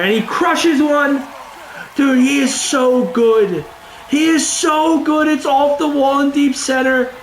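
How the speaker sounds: high, thrilled gushing over the hit; excited. Real, not acted. Gamer gushing to his viewers about his prospect's homer, thrilled and high. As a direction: rave about the swing, voice jumping up. Player's hitter crushes a homer.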